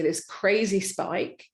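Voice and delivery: ordinary talk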